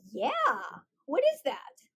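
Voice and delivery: put-on high-pitched, eager child's question; piping mock-child curiosity; imitated curious kid. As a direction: ask it like an intrigued little kid. Voicing curious kids' reply.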